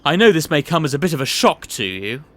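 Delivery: chuckling, patronising boss; jovial boss. Acted. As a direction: laugh him off then lean in to confide. Boss reassures, then confides.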